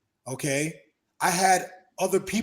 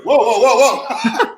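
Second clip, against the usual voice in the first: much louder startled whoas through a laugh; 7 semitones higher, 13 dB louder, slower than usual. Retold or imitated. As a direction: react like a friend stopping a fight. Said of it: "And he goes".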